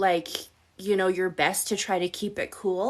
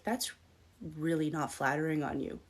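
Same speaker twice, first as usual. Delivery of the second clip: quiet snide remark; 3 semitones lower, 8 dB quieter than usual. Retold or imitated. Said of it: "he'll be like".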